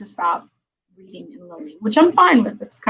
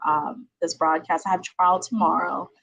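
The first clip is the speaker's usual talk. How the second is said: usual speaking voice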